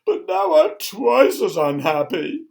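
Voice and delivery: high, loud, drawn-out mock wailing; theatrical sobbing king. A narrator's reading. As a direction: bawl it in hammed-up misery. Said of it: "he sobbed".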